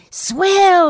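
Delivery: loud, high, drawn-out exclamation; delighted agreement. A narrator's reading. Boy agrees to dig for the secret, loudly delighted. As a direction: jump at the offer, thrilled.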